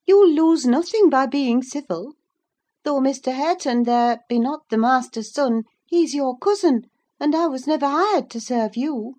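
high, loud, firm rebuke; reproving servant woman. Acted. Servant reproves the bossy girl, high and firm.

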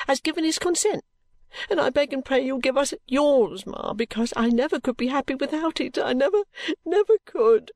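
high-pitched tearful pleading; acted weeping daughter, voice pitched high; sobbing. A narrator's reading. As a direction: beg mother through sobs. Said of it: "sobbed Caddy".

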